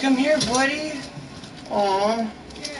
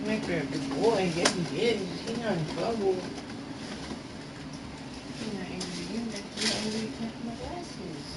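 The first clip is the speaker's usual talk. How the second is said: much softer, cooing to the dog; soft pet talk; 9 dB quieter than usual. Real, not acted. He calms his dog Max, speaking softly and tenderly. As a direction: hush it down like soothing a pet.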